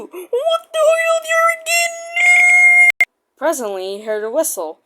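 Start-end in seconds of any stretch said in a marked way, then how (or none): 0.0-3.1 much higher, slow squeaky voice; silly high-pitched character voice. Acted. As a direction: squeal it like a cartoon truck.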